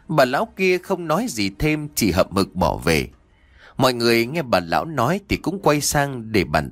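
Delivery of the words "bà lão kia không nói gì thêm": ordinary delivery, nothing marked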